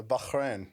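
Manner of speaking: Bahrain with a throaty, breath-like h; mimicked Arabic pronunciation. Host mimicking the guest saying Bahrain, throaty h. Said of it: "it's like every time you say".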